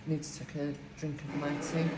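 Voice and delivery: ordinary delivery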